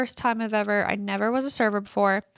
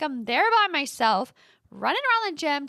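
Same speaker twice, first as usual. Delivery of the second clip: ordinary talk, nothing marked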